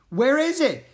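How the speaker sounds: high, loud, alarmed question; echoing cry. A narrator's reading. Jack joining Gunner's frantic search, echoing cry. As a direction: echo the panicked shout. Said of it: "Jack echoed".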